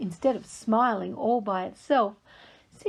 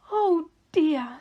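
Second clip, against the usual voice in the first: a sighed, slightly higher oh dear; sighing; 6 semitones higher, 3 dB louder than usual. Acted. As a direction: breathe it out as a sigh. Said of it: "sighed Kiki Kangaroo".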